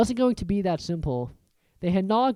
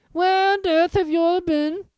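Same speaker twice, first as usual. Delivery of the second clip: much higher, louder prim voice; affronted portrait lady, higher and louder; indignant Fat Lady; 13 semitones higher, 7 dB louder than usual. A narrator's reading. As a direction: scold the late children.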